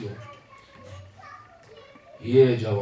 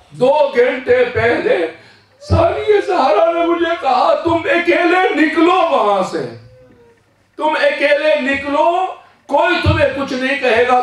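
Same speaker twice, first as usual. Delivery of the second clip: impassioned cry; 10 semitones higher, 4 dB louder than usual. Acted. Youth recounting Zainab's command.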